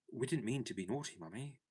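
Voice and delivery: put-on quick, tearful child's plea; a child's apologetic sob; sobbed. A narrator's reading. Children to Mother after a scolding, tearful. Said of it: "sobbed Bobby and Peter".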